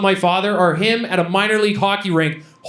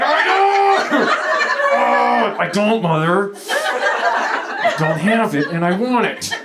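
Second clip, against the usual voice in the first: hammed-up tantrum, starting high; mock whiny outburst, drawn out at the end; childish protest; 5 semitones higher, much slower than usual. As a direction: protest to mother like a thwarted kid. Acts out childish protest to mother.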